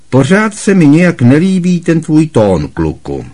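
loud, high scornful rant; scornful Aunt Marge. A narrator's reading. Aunt Marge scorning Harry over tea, loud and contemptuous. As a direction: lecture him with contempt.

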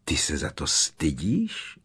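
low-pitched bewildered question; uncomprehending. A narrator's reading. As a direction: ask it slowly, not understanding. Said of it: "zeptala se Hermiona nechápavě".

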